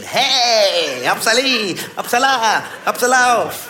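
high, exuberant mock greeting; hammed-up merry skipping call; skipping friend. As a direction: sing it out as if prancing along. Imitating friend skipping through the foyer.

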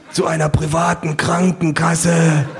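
slow, high, strained gurgle; choking, mortally wounded pollster; dying rasp. A narrator's reading. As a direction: drag out the last question while dying. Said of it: "röchelt einer".

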